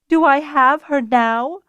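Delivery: high, loud, slow anguished cry; voice breaking. A narrator's reading. Nuella cries out to Fiona, voice breaking. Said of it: "her voice breaking".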